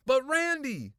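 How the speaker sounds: high, loud cry; exasperated protest. A narrator's reading. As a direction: wail the protest urgently. Girl protesting to her brother.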